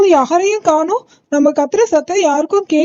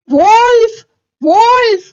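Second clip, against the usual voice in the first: shouting for help; 6 semitones higher, slower than usual. Acted. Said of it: "Peter கத்துற சத்தம் கேக்குதா".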